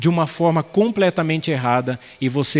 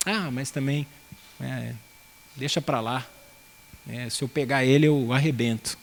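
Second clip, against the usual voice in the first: put-on much softer, grumbling boy brushing it off, then a muttered threat; mock tough mumble; 3 semitones lower, 7 dB quieter than usual. Boy provoked by friends mutters a half-hearted threat, much softer. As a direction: play it cool under your breath. Boys egging on a fight.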